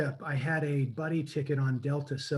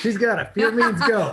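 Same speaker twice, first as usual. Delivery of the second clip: excited; 6 semitones higher, 10 dB louder than usual. Real, not acted. Excited agreement with laugh.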